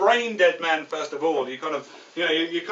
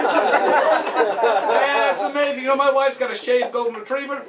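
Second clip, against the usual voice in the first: loud, high cocky wise guy; Joe Pesci; 6 semitones higher, 7 dB louder, slower than usual. Acted. Kiosk man chatting up a nervous driver, cocky. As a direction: brag like a movie mobster. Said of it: "kind of the cocky Joe Pesci guy".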